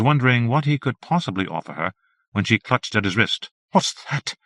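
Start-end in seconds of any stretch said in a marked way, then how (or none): 3.7-4.4 startled breathy whisper. A narrator's reading. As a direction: hiss it under your breath, alarmed. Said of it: "she whispered".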